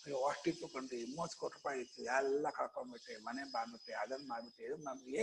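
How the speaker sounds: low, soft whining of a man who lost everything; imitating a self-pitying rich man's lament; mock lament. Mimics man lamenting lost wealth.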